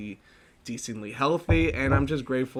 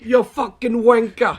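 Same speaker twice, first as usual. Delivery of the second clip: loud, high hammed-up Cockney "wanker"; mock Cockney insult; 8 semitones higher, 8 dB louder than usual. Friend hurling a mock Cockney insult across the mic. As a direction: spit it out like a cartoon Londoner. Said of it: "I'm Cockney".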